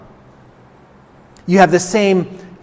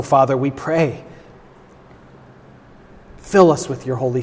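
public prayer; 4 dB quieter than usual. Real, not acted. Pastor prays to the Father for the church, reverent prayer. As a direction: pray it unhurried, settling softer as it goes.

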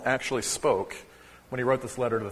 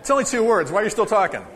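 mock scolding; 6 semitones higher, 4 dB louder, faster than usual. Real, not acted. Teasing a chatty congregation.

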